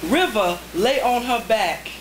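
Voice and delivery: spare, paused haiku reading; deliberate poetry reading; haiku recitation. Acted. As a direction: let each haiku land.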